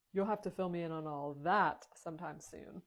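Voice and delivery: ordinary talk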